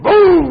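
loud high boom sound effect; mock explosion. [name]'s sound effect bang for the scene. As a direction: blurt out a comic bang. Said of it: "sottolinea le mie parole con acconci rumori".

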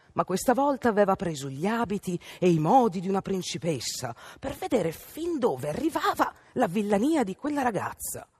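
usual speaking voice

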